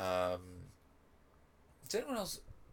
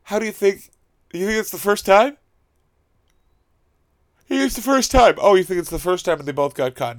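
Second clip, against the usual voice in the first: sarcastic shouting rant; 9 semitones higher, 11 dB louder than usual. Real, not acted. Sarcastic ranting at defenders.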